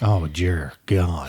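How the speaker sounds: low, slow, drawn-out groan; dread. Real, not acted. Husband bracing as his wife starts the next complaint, slow low groan. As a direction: sink into it, dreading what's next.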